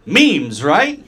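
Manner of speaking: loud high sheepish memes, right?; awkward cover-up. Retold or imitated. Customer to bank teller, loud sheepish cover-up. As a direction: bluff past the embarrassment.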